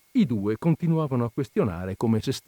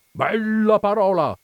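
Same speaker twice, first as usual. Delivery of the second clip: high, loud, stretched cry; shouted enthusiasm; 10 semitones higher, 6 dB louder, slower than usual. A narrator's reading. Coachman dragging his friend along, shouting. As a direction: bellow it slowly, pitched up. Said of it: "gridava il cocchiere".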